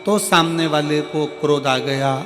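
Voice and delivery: forceful preaching. Real, not acted. Preacher drives home point to crowd.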